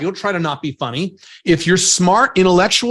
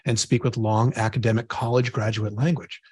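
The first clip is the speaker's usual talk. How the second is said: very slow, low, soft mock-academic delivery; slow academic talk; 7 semitones lower, 7 dB quieter than usual. Shown as a demonstration. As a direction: speak slowly and quietly, parodying a college lecturer. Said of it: "if I have to talk slow".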